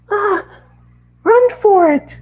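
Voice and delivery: very high, loud frightened cry; squealing pony fleeing the ringtone; panicked pony shriek. Acted. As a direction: shriek and flee from the noise.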